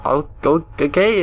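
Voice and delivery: usual speaking voice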